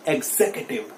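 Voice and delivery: 'executive' as eg-ZEK-uh-tiv, stress on zek; right pronunciation. Shown as a demonstration. Teacher has students repeat, right pronunciation eg-ZEK-uh-tiv. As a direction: stress zek in 'executive', weak vowel after it. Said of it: "मेरे साथ बोलिए".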